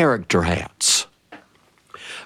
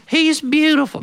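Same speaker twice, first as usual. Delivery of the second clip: even higher, swooning; doting mother. Retold or imitated. Mother dismissing the aunts, higher and swooning. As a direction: swoon over the boy's curls. Mother dotes on son's curls.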